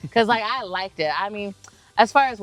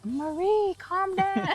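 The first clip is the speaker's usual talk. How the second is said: lightly voiced church ladies; pleading calm down in a high voice; high church ladies, soothing; 13 semitones higher, slower than usual. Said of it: "they were like".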